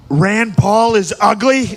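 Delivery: Trump impression. Comic retelling the debate, Trump's slow jab. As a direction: drawl it out as Trump. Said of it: "he was just like".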